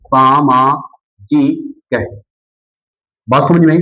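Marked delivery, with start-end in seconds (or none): 0.1-1.9 word stretched slowly syllable by syllable; drawn out. Shown as a demonstration. Teacher dictating the new word to students, slowly drawn out. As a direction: spell it out slowly for the class. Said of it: "क्या हो जाएगा?".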